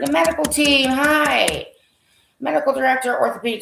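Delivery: loud, high-pitched greeting; excited discovery. Real, not acted. Speaker spots the medical team on the staff list, excited. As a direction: call it out high and loud on finding it. Finds the list he was seeking.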